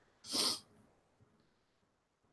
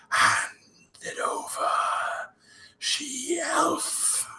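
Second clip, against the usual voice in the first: harsh, drawn-out whisper; slow, breathy villain whisper; menacing villain; much slower than usual. Acted. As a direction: whisper a sinister command, stretching every word. GM voices menacing villain's command.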